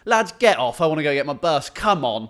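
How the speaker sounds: loud impatient bus driver; a loud, impatient hurry-up from the driver. Retold or imitated. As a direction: hurry them off the bus, loudly. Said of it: "he went".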